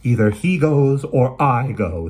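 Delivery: slow, loud, unbending ultimatum; mother forcing her son's hand, slow and firm; firm as a rock. Acted. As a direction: lay down the ultimatum, each word weighted. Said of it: "firm as a rock".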